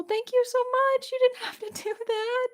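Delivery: high squealing thank-you with a laugh; overwhelmed happy squeal; excited squeal. Real, not acted.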